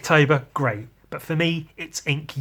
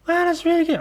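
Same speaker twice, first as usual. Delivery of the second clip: imitating model; 4 dB louder, faster than usual. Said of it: "She went".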